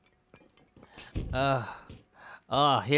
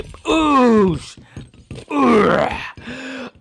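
high, loud effort cries; exaggerated high impact yells; smashing yells; 8 semitones higher, 7 dB louder than usual. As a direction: yell with each playful smash. Smashing with his booty.

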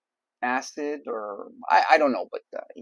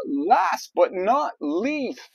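just bit tongue; 10 semitones higher, 5 dB louder, much slower than usual. Real, not acted. Drawn-out announcer call, tongue bitten.

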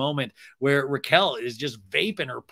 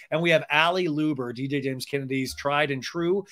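normal talking voice

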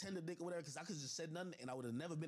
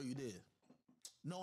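ordinary delivery

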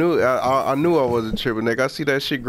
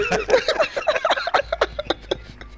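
very high-pitched cackle; helpless squeaky laugh; laughing hard. Real, not acted. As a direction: burst into high laughter at your own joke. Cracking up at camera joke.

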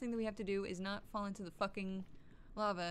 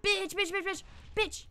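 loud, high staccato yelling climbing higher; frantic shouting; 8 semitones higher, 7 dB louder, slower than usual. Real, not acted. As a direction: fire the word off in a loud, rising volley. Panicked attack on a game enemy.

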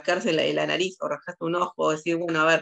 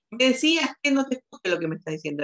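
higher-pitched, mildly raised voice; 7 semitones higher than usual. Acted. Psychologist imitating the other person answering, mildly raised voice. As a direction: lift the voice a little, acting it out. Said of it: "va a subir la voz".

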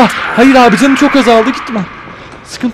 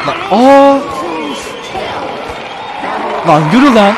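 yelling at enemy; 3 semitones higher than usual. Real, not acted. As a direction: shout at the boss in triumph.

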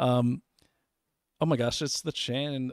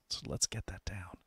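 whispered conspiratorial aside; sarcastic whisper; 5 semitones lower, 13 dB quieter than usual. Acted. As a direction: whisper it like a sly secret plan.

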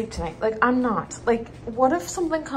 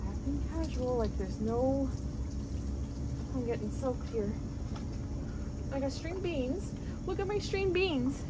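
hushed; 2 semitones higher, 12 dB quieter than usual. Real, not acted. Keeping quiet near the nest.

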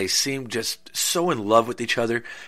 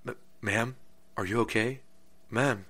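soft, uncertain voice to someone lying still; hesitant, hushed calling; 10 dB quieter than usual. Acted. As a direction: gently check if she's all right. Boy finds a body, calls softly.

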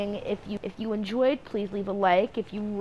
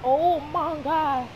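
drawn-out, louder shocked oh my God; surprised; 5 semitones higher, 4 dB louder, slower than usual. Real, not acted. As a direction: stretch the oh in shock. Reacting to a crash in game.

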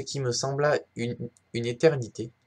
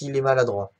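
normal talking voice

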